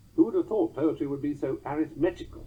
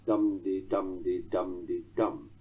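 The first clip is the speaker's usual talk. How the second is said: "dum-de-dum-de-dum-de-dum" chanted slowly, stress on each DUM; slow even beat, ending squarely on a stressed "dum"; DUM-de rhythm cut short on a final DUM; docked trochaic beat; 5 semitones lower, slower than usual. Shown as a demonstration. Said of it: "one with docked weak endings".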